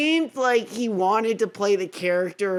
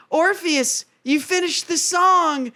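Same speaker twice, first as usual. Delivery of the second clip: lightly voiced Eurydice; 8 semitones higher than usual. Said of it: "Eurydice is like".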